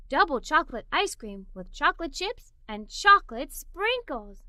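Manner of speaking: ordinary delivery, nothing marked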